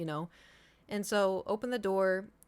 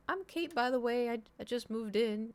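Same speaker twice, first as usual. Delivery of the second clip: higher, friendly voice for meeting a neighbour; polite introduction; 5 semitones higher than usual. Retold or imitated. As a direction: introduce yourself sweetly and a bit awkwardly.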